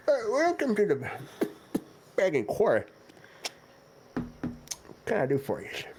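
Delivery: high, wavering ancient shopkeeper; very old man. Acted. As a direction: greet them like a doddering old turtle. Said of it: "God, he's ancient".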